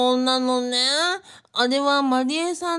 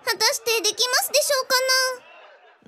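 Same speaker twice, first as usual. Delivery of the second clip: much higher, fast, squeaky put-on character voice; 10 semitones higher, much faster than usual. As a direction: jump way up into a quick, strange character voice. Margarita doubts in her voice. (甲高く早口の作り声)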